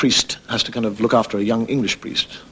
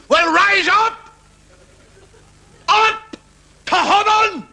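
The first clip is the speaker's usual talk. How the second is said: Paisley preaching. Retold or imitated. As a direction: thunder from the pulpit like a fire-and-brimstone preacher. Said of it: "and he talks like that".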